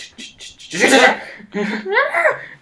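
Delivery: high, loud mimicked train sounds; imitated train noise. Player mimicking how oddly the train moves, mock train noises. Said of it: "It's like".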